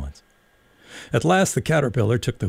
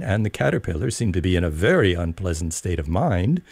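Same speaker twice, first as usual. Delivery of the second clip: ordinary delivery, nothing marked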